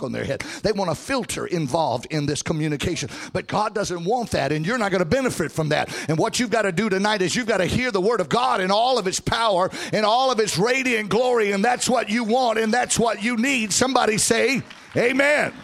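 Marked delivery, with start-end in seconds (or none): 6.1-15.5 rising preacher's whoop, sung lines; southern preacher. Acted. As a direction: build to a sung, shouted climax. Preaching builds to full force.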